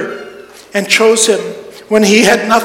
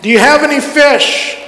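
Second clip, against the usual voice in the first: raised, higher voice calling to the boat; calling out across water; 4 semitones higher than usual. Retold or imitated. Jesus to fishermen in the boat, calling out. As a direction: call out to friends far off. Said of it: "he calls out to them".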